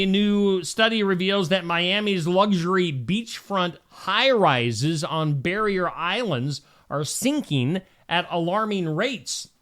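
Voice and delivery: usual speaking voice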